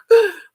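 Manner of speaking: sharp high startled gasp; loud mock-panicked gasp; alarmed gasp. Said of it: "I'm like".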